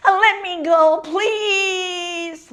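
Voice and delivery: high, drawn-out begging; pleading young grebe. A narrator's reading. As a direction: stretch the plea like an insistent youngster. Young grebe begs Turtle.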